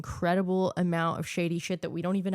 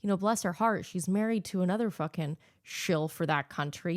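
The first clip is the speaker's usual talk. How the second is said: usual speaking voice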